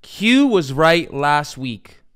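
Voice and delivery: slow, deliberate, every word weighted; grudging slow admission. Real, not acted. Host admitting to viewers his rival was right, slow and deliberate. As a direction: drag it out like a painful confession. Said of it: "pay close attention".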